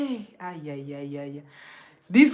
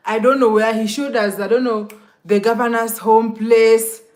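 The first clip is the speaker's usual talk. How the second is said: ordinary talk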